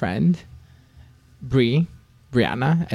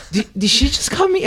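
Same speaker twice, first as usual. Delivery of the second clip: high, laughing disbelief; pitched-up laughing shock; 13 semitones higher, 3 dB louder than usual. Retold or imitated. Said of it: "got shocked".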